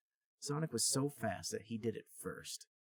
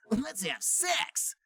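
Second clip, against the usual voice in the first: much higher, very slow drawn-out; Sonic thinking; 4 dB louder, much slower than usual. Acted. Sonic thinking while kissing Amy, slow and high. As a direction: savor every word.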